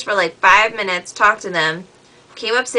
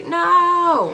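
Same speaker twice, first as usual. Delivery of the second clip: put-on kid yelling no again; 10 semitones higher than usual. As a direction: shout no back like the stubborn kid. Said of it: "that's what he".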